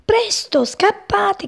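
high-pitched warning cry, mildly projected; urgent cry. A narrator's reading. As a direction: cry out to make them flee. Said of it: "gridò una voce".

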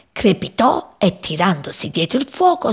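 ordinary delivery

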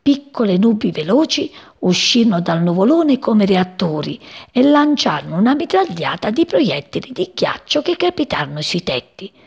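usual speaking voice

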